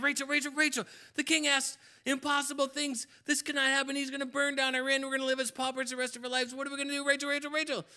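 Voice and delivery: high-pitched frantic pleading; panicked father. Acted. Innkeeper begging Rachel for help with the king's riddles, frantic. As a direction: wail it out in a panic.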